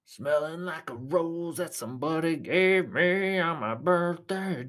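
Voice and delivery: very slow, drawn-out put-on voice; comic modulator voice. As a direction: stretch every word out in a silly voice. Switches into another comic modulator voice.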